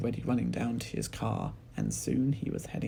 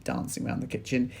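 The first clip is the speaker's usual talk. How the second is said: ordinary delivery, nothing marked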